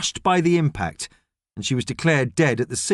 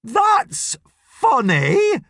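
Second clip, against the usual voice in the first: high, stretched-out yelled words; very slow, patronising holler; 10 semitones higher, 3 dB louder, much slower than usual. A narrator's reading. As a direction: yell it slowly, syllable by syllable. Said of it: "yells Gladys slowly".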